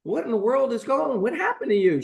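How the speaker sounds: high, rising alarmed cry; shocked alarm. Retold or imitated. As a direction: recoil in horror at what's at the door. Doctor finds mangled patient.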